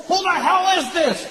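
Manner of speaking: prank caller shout. Retold or imitated. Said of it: "prank phone calls".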